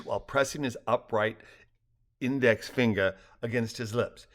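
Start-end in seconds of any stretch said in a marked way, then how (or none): none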